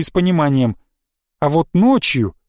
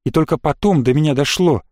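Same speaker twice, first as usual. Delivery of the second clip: plain reading voice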